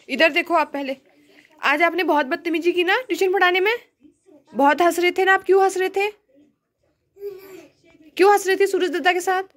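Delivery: mock scolding. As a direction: pretend to scold the child for misbehaving. Mother mock-scolding the child. (बच्चे को झूठमूठ डाँटते हुए, ऊँची और तेज़ आवाज़ में)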